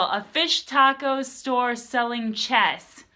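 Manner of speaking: ordinary delivery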